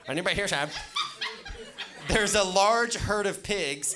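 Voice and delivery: loud, high read-along voice; booming, high-pitched reading out loud; leading group recitation. Shown as a demonstration. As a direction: project it big for the crowd to follow. Leads group reading aloud loudly.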